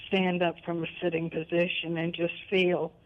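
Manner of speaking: usual speaking voice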